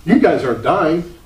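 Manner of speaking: high, loud, scoffing exclamation, then teasing; mocking fellow pastors. Pastors ribbing the Baptist about hiding from society, scoffing. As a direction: lampoon the other ministers' scorn for Baptists. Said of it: "And they're going".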